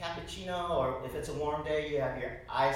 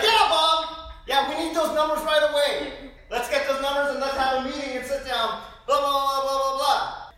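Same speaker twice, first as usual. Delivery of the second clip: loud, high, brash businessman on his cell; mock loud phone guy barking about numbers; loud phone talker; 10 semitones higher, 10 dB louder than usual. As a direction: bellow into the phone for the whole cafe. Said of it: "the loud person on the cell phone".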